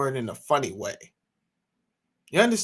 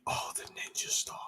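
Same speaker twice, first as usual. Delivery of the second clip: whisper; 13 dB quieter than usual. Real, not acted. Aside whispered.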